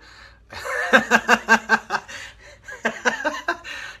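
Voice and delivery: loud, high staccato laughing fit; big cackling laugh. Real, not acted. Comic cracking up at his own story, loud cackle. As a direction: burst into real, prolonged laughter.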